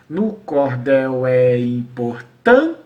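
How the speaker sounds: drawn-out scansion, last syllable detached; counting syllables. Shown as a demonstration. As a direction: spell the verse out beat by beat. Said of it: "que é a sílaba tônica".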